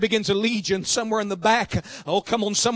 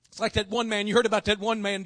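ordinary talk, nothing marked

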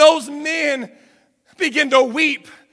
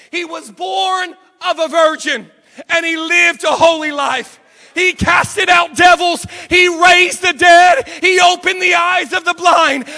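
loud, high-pitched preacher's cry; screamed preaching; 3 semitones higher, 5 dB louder than usual. Retold or imitated. Pastor on a crate preaching to a prison, screamed at full force. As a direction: preach it at the top of your lungs. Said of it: "began to scream from the top of my lungs".

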